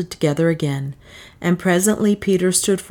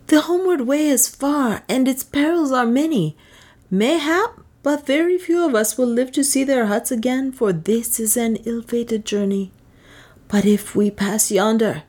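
lightly voiced, higher headman pleading; a hint of a worried headman, pitched up; Peter the headman; 7 semitones higher than usual. A narrator's reading. Headman asking Leonard to let them turn back, lightly pitched up.

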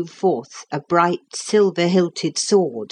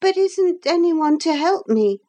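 put-on high-pitched, worried boy's plea; deeply uneasy; 13 semitones higher than usual. A narrator's reading. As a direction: ask for help in a high, uneasy voice. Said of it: "deeply uneasy".

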